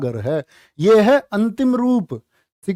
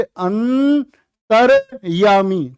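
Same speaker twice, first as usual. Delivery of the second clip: अंतर्यामी dragged out, each syllable held; very slow demo; much slower than usual. Shown as a demonstration. Teacher showing where the र goes in अंतर्यामी. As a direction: sound out अंतर्यामी at a crawl. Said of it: "तो कहां बोलोगे".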